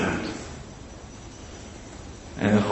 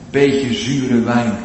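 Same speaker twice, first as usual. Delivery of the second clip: usual speaking voice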